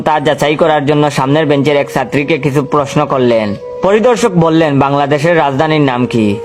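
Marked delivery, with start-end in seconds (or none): none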